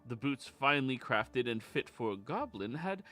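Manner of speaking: storyteller recounting a tale, measured and grand; grand rhythmic bard. Acted. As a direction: tell it like a bard by the fire. Bard recap of the session.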